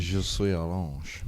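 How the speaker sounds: put-on slow, halting French accent; schoolboy French. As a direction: put on classroom French, drawn out. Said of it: "all I can remember from French lessons".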